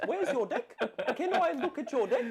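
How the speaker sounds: put-on high, laughing New Zealand accent; Kiwi accent. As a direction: tease in mock Kiwi vowels. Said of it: "put on the accent".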